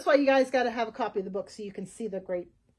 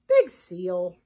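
a goat's catchphrase, lightly voiced; punchy and louder, dropping in pitch; Nina; 7 semitones lower, 7 dB louder than usual. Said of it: "And she might say".

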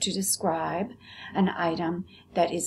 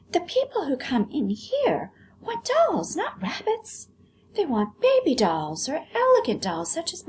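high prissy doll voice; haughty doll, very high; vain china doll; 10 semitones higher than usual. Acted. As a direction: look down your nose at the rabbit.

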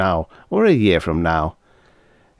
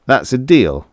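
emphatic; 5 semitones higher, 6 dB louder than usual. Acted. Newton seals the deal emphatically.

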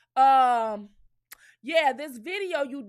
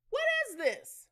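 incredulous; 11 semitones higher than usual. Shown as a demonstration. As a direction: question jumps high on "What". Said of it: "repeats the question".

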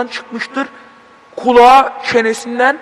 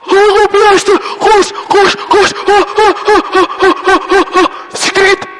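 panicked screaming; 11 semitones higher than usual. Real, not acted. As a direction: shriek and run for your life. Monster appears, player flees in panic.

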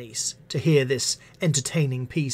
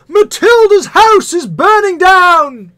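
roared out at full force, much higher; roaring; 16 dB louder, slower than usual. A narrator's reading. Firemen roaring through the town, full-throated bellow. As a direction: bellow it like a fire brigade charging through town. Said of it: "roaring through the town".

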